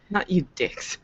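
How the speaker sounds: laughing through the words. Acted. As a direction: giggle out the line. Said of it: "Maya's like".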